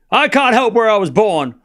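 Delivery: high, loud, hammed-up protest; mock-upset outburst. Streamer protesting to chat about accent critics, mock-upset.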